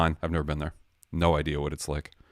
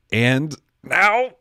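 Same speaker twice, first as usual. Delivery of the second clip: loud, high, mic-clipping build-up; getting all angsty and loud; worked up; 9 semitones higher, 10 dB louder than usual. Real, not acted. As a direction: announce it big, pitch climbing. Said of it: "I'm getting all angsty".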